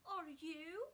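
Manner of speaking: a hint of a cautious girl; Mary's voice. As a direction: toss the question back, wary. Mary answers Colin.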